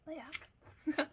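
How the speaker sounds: usual speaking voice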